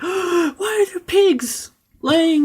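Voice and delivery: very high, slow, shocked cry; mock shock. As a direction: gasp and squeal at the hanging pigs. Retold shock at hanging pigs.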